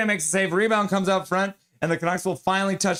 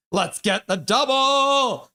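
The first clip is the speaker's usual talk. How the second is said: high, slow, stretched-out cheer; excited fan battle cry; rallying cry; 8 semitones higher, 4 dB louder, much slower than usual. Acted. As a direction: draw the last word out loud and high. Acts out a fan's cheer.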